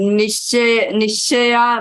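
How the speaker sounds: normal talking voice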